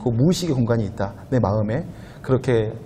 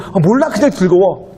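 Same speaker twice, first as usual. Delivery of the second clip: giddy, higher put-on voice, carefree; mock giddy; 6 semitones higher, 4 dB louder than usual. A preacher mimicking a person giddy for no reason, higher and carefree. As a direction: act out someone happy for no reason.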